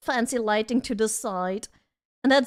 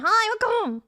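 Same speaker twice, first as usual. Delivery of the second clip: cheery greeting; 9 semitones higher than usual. Real, not acted. Streamer greets new arrivals, cheery. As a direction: sing out a high welcome.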